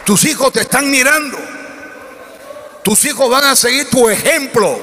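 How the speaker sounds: high, ringing sermon shout; full-force preaching. Real, not acted. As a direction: build to a high cry, drawing out the last words.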